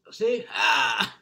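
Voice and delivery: sudden loud yell; intense burst. Shown as a demonstration. Showing viewers how he turns intensity on, yelling. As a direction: flip the switch to full intensity. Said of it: "then you bring it back".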